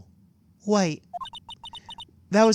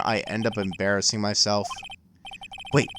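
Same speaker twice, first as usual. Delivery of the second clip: usual speaking voice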